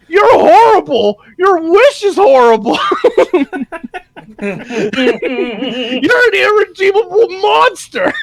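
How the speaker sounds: high, loud squeaky denunciation dissolving into laughter; outraged cricket. Retold or imitated. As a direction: scream at him like a tiny furious cricket. Cricket denouncing Horner.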